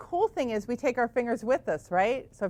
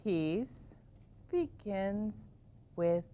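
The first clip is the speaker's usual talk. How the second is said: softer, drawn-out chant; slow mantra; 6 dB quieter, much slower than usual. Shown as a demonstration. Teacher guiding kids through the mantra slowly and softly. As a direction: breathe each word out slowly. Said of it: "Try it again".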